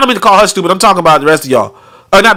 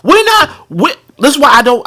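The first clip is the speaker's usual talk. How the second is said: usual speaking voice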